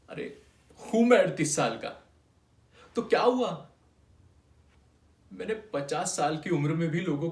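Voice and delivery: loud, high, indignant comeback; defiant retort. Acted. Clerk answering a taunt about his age, defiant. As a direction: throw it back at him loudly.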